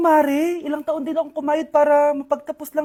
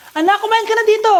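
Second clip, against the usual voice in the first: loud, high call to the table; 7 semitones higher, 5 dB louder than usual. Acted. Mother calling her child to a meal, calling out loudly. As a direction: summon the child to come and eat.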